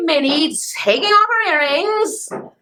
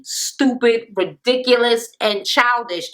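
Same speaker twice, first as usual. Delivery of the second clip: ordinary talk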